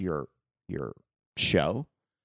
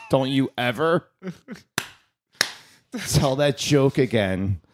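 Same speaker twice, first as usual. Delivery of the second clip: louder, slow mock scolding; mock stern warning; 5 semitones higher, 6 dB louder, slower than usual. Dad warning son off a joke, mock stern. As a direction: lay down the law, hammed-up.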